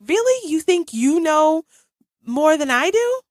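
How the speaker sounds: high, drawn-out mock disbelief; incredulous. Mother to son who says he already knew, incredulous.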